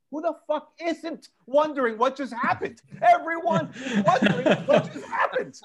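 high, loud comic outrage; hammed-up indignant outburst; incredulous rant. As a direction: shriek it in exasperated disbelief, close to laughing. Incredulous reaction to pilot's announcement.

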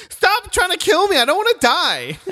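high, loud mock panic. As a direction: whine it in fake terror.

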